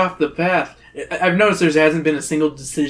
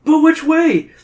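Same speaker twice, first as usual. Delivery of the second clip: high, louder urgent question; panicked; 13 semitones higher, 5 dB louder, slower than usual. A narrator's reading. Fleeing the stone beast with Zoe, panicked. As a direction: cry it out, mid-escape.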